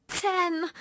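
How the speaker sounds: high little weepy voice; small, high-pitched and upset; tearful answer. Acted. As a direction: answer as a crying child. Weeping child answers police.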